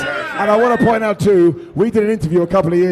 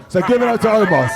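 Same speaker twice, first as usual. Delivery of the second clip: crowd hype shout; 4 semitones higher than usual. Real, not acted. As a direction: call out to the crowd. Hyping the crowd.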